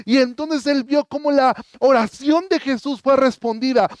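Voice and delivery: loud, high, impassioned preaching; preaching at full force. Acted. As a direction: preach it out with rising fervor. (predicación apasionada, más fuerte y aguda)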